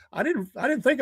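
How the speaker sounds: breaks into a high laugh mid-word; real laugh cutting off the words. Real, not acted. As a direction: laugh it out, caught off guard. Laughs at brake cleaner idea.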